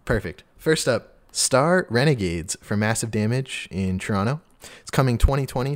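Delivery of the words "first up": usual speaking voice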